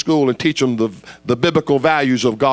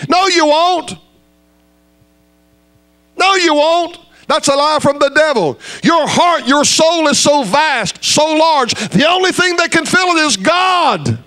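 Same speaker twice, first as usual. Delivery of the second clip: full-force preaching; 12 semitones higher than usual. Acted. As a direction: hammer it home to the crowd. Preacher refutes worldly happiness.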